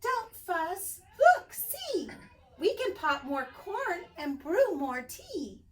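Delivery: very high tiny mouse voice; squeaky mouse. Acted. Mouse complaining about the damp cave, squeaking. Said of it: "Mouse squeaks".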